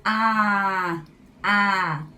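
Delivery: A said loud with the mouth wide open and a big smile. Shown as a demonstration. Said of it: "abrindo bem a sua boca e abrindo um sorrisão".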